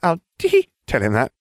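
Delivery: murmured airhead giggle; ditzy witch. A narrator's reading. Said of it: "the blonde murmured".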